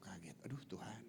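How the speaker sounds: startled breathy whisper; shocked whisper. Retold or imitated. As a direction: gasp it out in a whisper. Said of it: "saya syok". (bisikan kaget)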